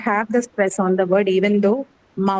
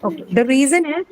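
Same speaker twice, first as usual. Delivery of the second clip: ordinary talk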